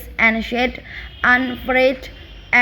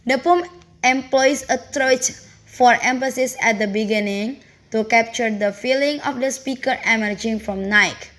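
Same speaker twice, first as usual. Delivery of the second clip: normal talking voice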